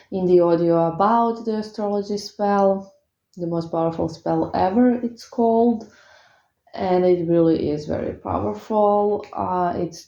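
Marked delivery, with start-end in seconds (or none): none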